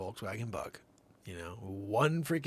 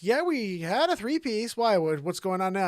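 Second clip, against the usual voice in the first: high loud wife voice; 11 semitones higher, 11 dB louder than usual. Retold or imitated. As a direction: play the wife, a bit alarmed. Wife answering husband.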